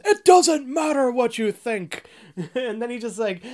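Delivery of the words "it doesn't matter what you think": booming wrestler's cut-off shout, pitched up and drawn out; The Rock. Acted. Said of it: "The Rock goes".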